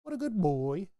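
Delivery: sing-song dog praise. Shown as a demonstration. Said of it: "that weird inflection in my voice".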